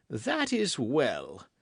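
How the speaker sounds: slow, drawn-out approval; satisfied king. A narrator's reading. King leaving the queen, slowly satisfied. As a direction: savour the victory. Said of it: "The king went away enchanted".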